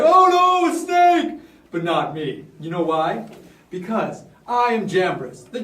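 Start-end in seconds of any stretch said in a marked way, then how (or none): 0.0-1.4 high, loud hammed-up alarm; nervous panic. Courtiers spot the snake, loud high mock panic. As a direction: cry out in mock fright. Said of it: "some of the people in the court were nervous".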